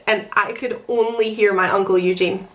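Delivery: ordinary talk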